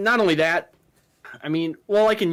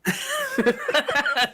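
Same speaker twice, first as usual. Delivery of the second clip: laughing. Real, not acted. Laughing at a joke.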